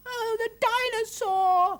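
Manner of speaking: put-on very high, loud, drawn-out child's wail; little girl aghast, squealing; dismayed cry. A narrator's reading. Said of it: "cried Arabelle in dismay".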